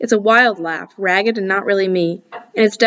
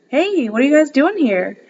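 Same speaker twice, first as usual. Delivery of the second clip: forced cheer; 6 semitones higher than usual. A narrator's reading. Forced pleasant greeting at door.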